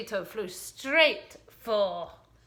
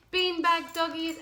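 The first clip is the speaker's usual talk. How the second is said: usual speaking voice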